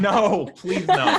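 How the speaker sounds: high, loud, drawn-out protest through laughter; laughing mock dread; laughing plea. Real, not acted. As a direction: beg off while laughing. Laughing plea against next episode.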